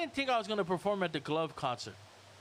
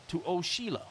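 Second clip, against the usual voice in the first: ordinary talk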